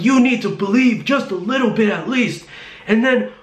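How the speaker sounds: very high, insistent mock yell; retold yelling. Imitating the driver urging her to believe, high mock yell. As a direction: imitate a zealot pushing hard, without full volume. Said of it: "He's yelling at me about God".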